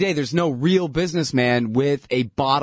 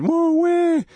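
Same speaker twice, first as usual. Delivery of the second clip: very high taunting weh; mock wail at them; pitched-up crybaby jeer; mock crying; 14 semitones higher, 3 dB louder than usual. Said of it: "pointed my finger and said".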